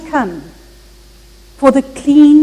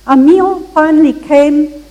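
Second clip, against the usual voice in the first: ordinary talk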